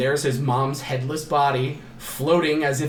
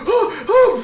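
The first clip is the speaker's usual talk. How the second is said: high, loud gasping "ooh"s; mock panicked hooting; 6 dB louder than usual. She watches his burning corpse, high panicked mock gasps. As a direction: imitate someone freaking out. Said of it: "freaking out".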